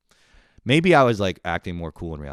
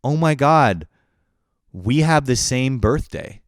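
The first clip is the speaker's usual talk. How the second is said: loud, slow gushing squeal; lightly voiced girl gushing about a shared birthday; excited girl; 6 semitones higher, 9 dB louder, slower than usual. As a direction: gush it like a thrilled girl. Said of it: "she's like".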